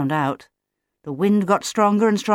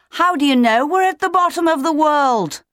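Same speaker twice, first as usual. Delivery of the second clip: high, raised chick's call; yelling across; 9 semitones higher, 4 dB louder than usual. A narrator's reading. Otto to Leo across their fathers' tummies, yelling. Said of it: "Otto yelled across to Leo".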